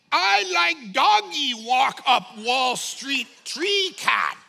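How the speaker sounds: slow, loud mock simpleton; dim-witted student. Mimicking a high school student's essay, hammed-up dim and slow.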